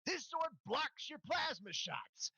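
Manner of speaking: high, slow shout over the fight; battle shout. Acted. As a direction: yell it mid-swordfight. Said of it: "you hear before the other side cuts out".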